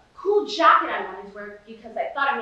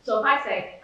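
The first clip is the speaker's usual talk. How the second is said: plain reading voice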